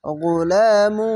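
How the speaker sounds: গুলামু chanted very slowly with the গুন্না held; slow recitation. Shown as a demonstration. Teacher modelling গুলামু, drawn out. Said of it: "এখানে ঈদগামে বা গুন্না".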